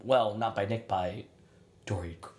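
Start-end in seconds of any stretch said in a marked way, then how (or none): 1.8-2.3 words cut off by a yawn. Real, not acted. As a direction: yawn mid-word. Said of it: "Sorry, I yawned".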